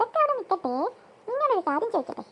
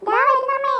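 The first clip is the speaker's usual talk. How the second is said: loud, higher-pitched answer; raised, high call; calling back; 5 semitones higher, 6 dB louder than usual. Acted. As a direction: call out to mother in another room. Children answer mother calling them.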